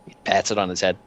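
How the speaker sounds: ordinary delivery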